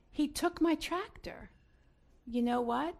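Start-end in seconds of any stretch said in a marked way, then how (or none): 0.1-1.5 put-on higher, slower child's protest; a hint of an aggrieved little kid; lightly voiced small boy. Four-year-old explaining why he hit his brother, lightly childlike protest. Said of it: "and he said".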